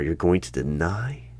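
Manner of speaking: normal talking voice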